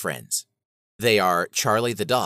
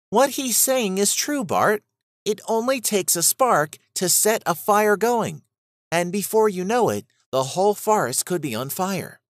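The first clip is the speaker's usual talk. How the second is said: put-on higher, earnest young rabbit voice; Bella the rabbit; 8 semitones higher than usual. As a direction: gently warn a friend as the rabbit.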